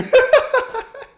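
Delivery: a sudden loud high laugh that breaks out; big burst of laughter. Real, not acted. Friend laughing at a wasted first-turn Solar Beam, loud high laugh. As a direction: crack up loudly at your friend's move.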